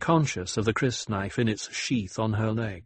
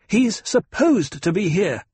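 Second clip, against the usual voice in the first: louder, higher angry retort; sharp, raised-voice interruption; snapped; 10 semitones higher, 6 dB louder than usual. A narrator's reading. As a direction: snap back, cutting the guard off. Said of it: "Jessica snapped".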